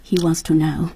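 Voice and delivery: ordinary delivery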